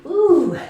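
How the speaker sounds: high loud whoop of relief; excited whoop. Real, not acted. Finishing twenty jumps.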